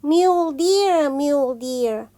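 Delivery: ordinary delivery, nothing marked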